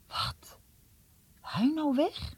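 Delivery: barely gets the words out in shock; boy hearing his old friend is leaving, stunned; shocked disbelief. Acted. Shocked by bad news.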